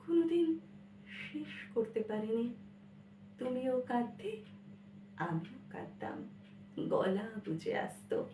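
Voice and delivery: subdued poetry recitation. Acted. Child and mother wept together, hushed sorrowful recitation. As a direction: let each phrase sink away quietly.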